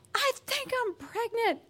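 high, slow mock wail; imitated bawling. Wife bawling in the shower to her husband, mock-wailing. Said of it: "I am bawling in the shower".

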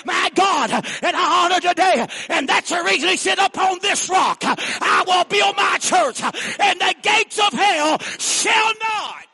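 high, loud, slow shouted scripture; preaching climax. Acted. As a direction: thunder the verse at the church.

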